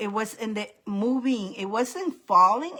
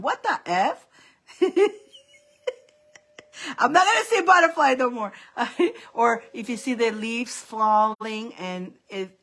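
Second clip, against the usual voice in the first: ordinary talk, nothing marked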